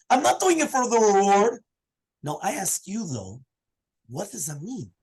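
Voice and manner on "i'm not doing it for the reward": joyful exclamation. Acted. Joyful rabbi explains his dancing.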